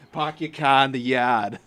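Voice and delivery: put-on loud Boston accent, no r's, slow. Friend doing the classic Boston phrase, in a slow Boston accent. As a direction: drop every r, Boston style, slowly. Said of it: "Going to Harvard".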